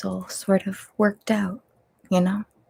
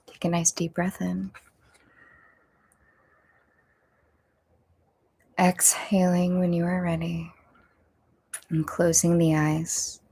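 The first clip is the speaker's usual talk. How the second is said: guided meditation. Acted. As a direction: ease them into breathing gently.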